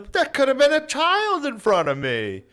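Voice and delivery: high mock old woman scolding, loud; shrill it out like an indignant old lady; squeaky old lady. Old woman voiced in story.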